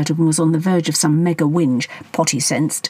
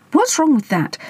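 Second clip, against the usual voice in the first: indignant; 11 semitones higher than usual. A narrator's reading. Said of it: "Potty had thought".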